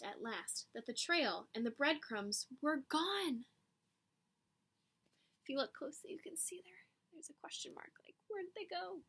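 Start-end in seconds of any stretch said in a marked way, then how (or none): 5.4-9.0 soft aside whisper. Real, not acted. Teacher showing class the picture, whispering. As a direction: lean in and whisper. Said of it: "pointing at picture quietly".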